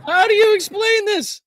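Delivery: very high, loud laughing squeal; helpless laughter. Real, not acted. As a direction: barely get it out through laughing.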